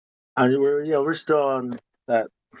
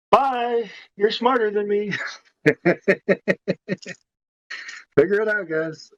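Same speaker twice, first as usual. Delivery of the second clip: high, taunting sing-off goodbye; mocking farewell; 7 semitones higher than usual. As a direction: toss off a mock-sweet, high-pitched bye. Mocking farewell to the smart ones.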